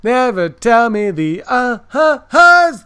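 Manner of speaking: slow, high, loud mock line; hammed-up movie quote. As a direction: ham up the famous line slowly. Twisting a Han Solo line.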